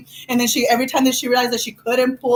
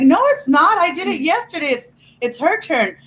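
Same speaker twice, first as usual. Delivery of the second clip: high, insistent child's denial, lightly acted; indignant protest; 6 semitones higher than usual. Acting herself denying it was her turn, indignant protest. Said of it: "I was like".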